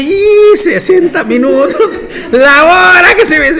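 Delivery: high, loud, slow through laughter; laughing mock lament; laughing complaint. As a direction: moan about the time, laughing. Laughing, retelling his complaint.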